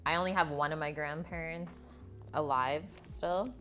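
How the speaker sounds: ordinary talk, nothing marked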